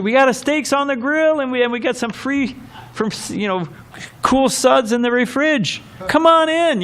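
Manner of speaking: high, hearty invitation from a partying neighbor; jolly party neighbor. Retold or imitated. Neighbor at a late birthday party inviting him over, high and hearty. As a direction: boom it out like a cheery guy with a beer.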